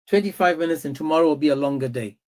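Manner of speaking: ordinary talk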